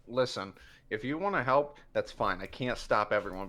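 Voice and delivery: ordinary talk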